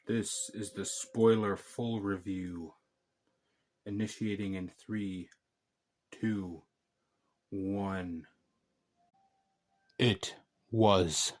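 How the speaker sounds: low, slow dramatic countdown voice, ending in a hushed whisper on terrible; spooky trailer narrator, half joking; mock movie-trailer voice. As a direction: ham up a doom-laden announcer, then whisper the verdict. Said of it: "I do not know what that was".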